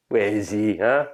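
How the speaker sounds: ordinary talk, nothing marked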